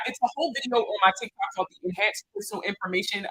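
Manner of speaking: ordinary talk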